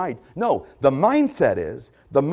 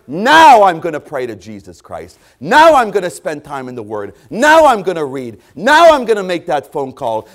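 loud, driving preacher's cadence, punching each Now; preaching at full force; 2 semitones higher, 9 dB louder than usual. Acted. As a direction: hammer every Now like a revival preacher. Preacher thundering repeated vows.

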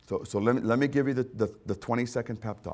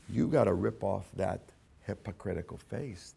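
ordinary talk, nothing marked

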